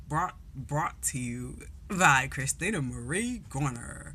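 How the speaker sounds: slow announcer read; lightly voiced TV sponsor tag; mock sponsor announcement. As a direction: present it like a sponsored segment. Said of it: "my extra ass".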